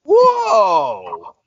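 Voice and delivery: high, loud drawn-out whoa. Acted. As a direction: shout a big surprised whoa. Over-the-top reaction.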